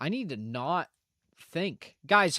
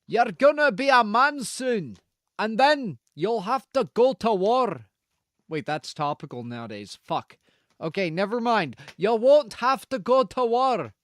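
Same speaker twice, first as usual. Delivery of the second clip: mock Scottish accent; 3 semitones higher than usual. Answering chat questions in a put-on Scottish accent. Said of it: "A part of me wanted it to be Scottish".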